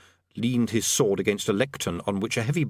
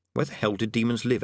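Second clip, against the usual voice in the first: ordinary delivery, nothing marked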